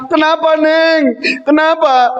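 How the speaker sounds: high, drawn-out worried cry; alarmed mother. Acted. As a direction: play a mum in a mock panic. Mother answering her crying daughter.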